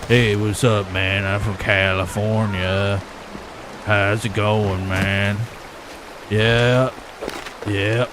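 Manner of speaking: low, slow, lazy surfer-dude voice; California slacker impression. Mocking Russian as a slow, low California guy. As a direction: drag it out like a sleepy California bro. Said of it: "I bet you talk like this".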